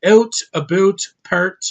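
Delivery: punchy imitation of a Canadian accent; mock Canadian accent. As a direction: exaggerate the Canadian way of saying these words. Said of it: "they say a couple things different".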